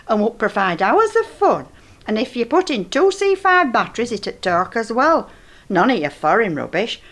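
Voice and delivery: ordinary talk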